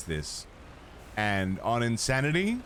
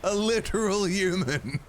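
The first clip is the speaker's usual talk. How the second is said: high, goofy Flanders voice; a silly cartoon neighbour voice; hammed-up Flanders squeak; Flanders impression; 10 semitones higher than usual. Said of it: "Just a clip of Flanders".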